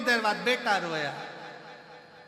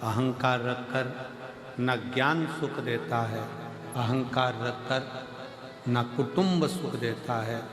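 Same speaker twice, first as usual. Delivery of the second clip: lower, steady preacher's cadence; sermon preaching; 5 semitones lower than usual. Acted. Preacher urges the congregation to drop their pride, sermon cadence.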